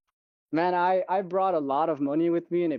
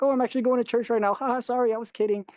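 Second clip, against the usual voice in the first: high, quick neighbor voice; hurried, high-pitched mock apology; imitated neighbor; 10 semitones higher, faster than usual. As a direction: imitate her hasty backtrack in a higher pitch. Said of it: "She's like".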